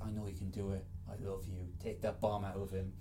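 a hint of a hammed-up romantic whisper; mock tender McDreamy.

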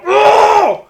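loud high-pitched rage yell; full-volume mock howl; imitated furious scream. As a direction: let out a frantic cyclist's scream. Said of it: "sen skriker han bara".

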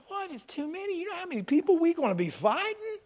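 high, soft disbelieving questions; incredulous protest. Acted. Gideon questioning God's order, incredulous protest. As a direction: climb high in hushed disbelief. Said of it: "And you're like".

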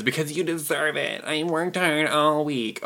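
slow, soft, whiny excuse; mock a lazy self-pitying kid. Mocking entitled spender's excuse.

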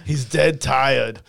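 slow Austrian action-hero one-liner; deliberate movie-star punchline; Arnold. Acted. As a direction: draw out the quip in a thick accent. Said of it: "Can you do Arnold?".

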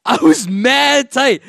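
high, slow, heated; worked up. Real, not acted. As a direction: let the frustration rise up. Retelling anger.

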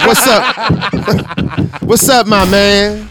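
loud hyped-up bro greeting; greeting guy impression. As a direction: boom in like the life of the party. Imitating guy walking in.

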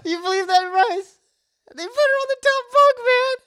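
high, loud, fast outrage; loud disbelief. Real, not acted. Complaining to Bryce about the top bunk, outraged. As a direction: appeal to a friend, voice leaping up. Indignant appeal to co-host.

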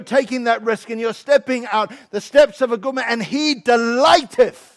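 loud, urgent sermon delivery; fired-up preaching. Real, not acted. Preacher assuring a friend who takes the risk, fired-up preaching. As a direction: preach it hard and emphatic.

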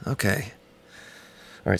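ordinary talk, nothing marked

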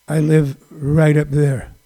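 slow, low impression. Pointing out his home in the Hollywood Hills, low slow impression. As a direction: drop low and draw the words out. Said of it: "Try to do my best [name], uh, voice".

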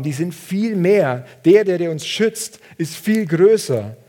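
triumphant assurance. Retold or imitated. Elisha assuring his servant, slow and rising triumph. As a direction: proclaim God's greater army with rising conviction. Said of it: "und sagt".

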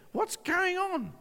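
high, drawn-out bewildered question; baffled inner voice. Retold or imitated. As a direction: ask it high and slow, in disbelief. Acts out his bewildered thought.

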